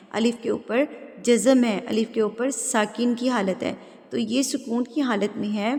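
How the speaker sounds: ordinary talk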